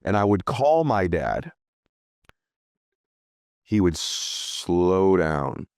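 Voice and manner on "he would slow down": very slow, drawn-out words; unhurried, lingering delivery; slowed right down. Retold or imitated. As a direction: stretch "slow" out long. Draws out the words as he describes it.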